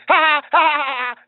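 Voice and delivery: high, loud taunting "ha ha ha"; imitating the caller's mocking laughter at him. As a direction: mimic a jeering cackle. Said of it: "So then he's like".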